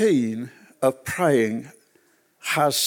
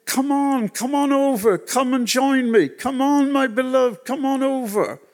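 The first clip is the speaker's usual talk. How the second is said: much higher, coaxing call of a dead father; 10 semitones higher than usual. Retold or imitated. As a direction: lure her gently across the river, voice pitched high. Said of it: "And he was saying".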